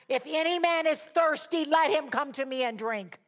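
high, loud public invitation; crying out loudly. Acted. Jesus calling the thirsty at the feast, loud cry. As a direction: cry it out to the whole crowd. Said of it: "he cried in a loud voice".

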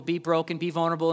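ordinary delivery

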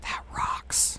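hushed approving whisper. Real, not acted. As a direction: whisper it softly. Whispered aside.

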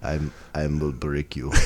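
low menacing Russian boxer voice; deep, cold Russian threat; Ivan Drago. Retold or imitated. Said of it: "Maybe because of Ivan Drago".

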